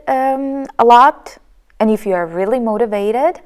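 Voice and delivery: halting speech with long ums and pauses; hesitant, filler-laden. Shown as a demonstration. Teacher demonstrates speech without breath control, hesitant. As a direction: stall and stretch words between breaths. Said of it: "I was out of breath".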